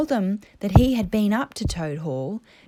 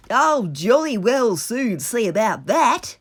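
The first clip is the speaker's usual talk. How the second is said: louder, blustering Toad voice; determined Toad; 4 semitones higher, 8 dB louder than usual. A narrator's reading. Toad vowing to retake Toad Hall, blustering. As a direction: puff up with defiance.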